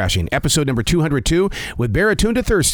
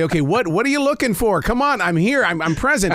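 higher-pitched mock demand to the universe; acted challenge; 7 semitones higher than usual. Host acting out a reply to the universe, exasperated challenge. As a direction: call it out, rising to an exasperated demand. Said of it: "That's when you say".

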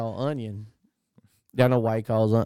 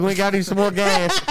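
usual speaking voice

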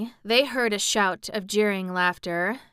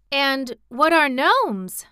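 higher, louder, slowly drawn-out question with mild fear; a touch of fright in a girl's question; half frightened; 6 semitones higher, 6 dB louder, slower than usual. A narrator's reading. Girl asking about the strange creatures, half frightened. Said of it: "half frightened".

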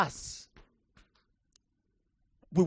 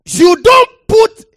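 "You don't put" shouted higher and louder, "don't" hammered; louder preaching repeat; 12 semitones higher, 20 dB louder than usual. Acted. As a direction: hit "don't" hard and loud.

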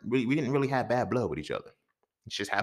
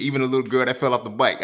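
ordinary delivery

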